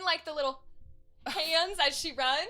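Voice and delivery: normal talking voice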